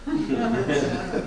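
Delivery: quiet amused chuckle; a breathy laugh at the punchline; short soft laugh. Real, not acted. Speaker laughs at the doctor's line, soft chuckle. Laughs at his own story.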